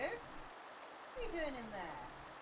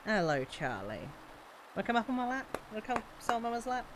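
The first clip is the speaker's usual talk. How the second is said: softer, coaxing voice for a little dog; soft pet talk; 8 dB quieter than usual. Real, not acted. Streamer coaxing her small dog onto her lap, soft and sweet. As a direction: coax the dog onto your lap gently.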